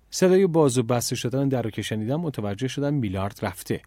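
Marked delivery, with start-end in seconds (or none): none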